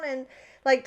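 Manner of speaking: normal talking voice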